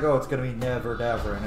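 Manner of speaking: ordinary talk, nothing marked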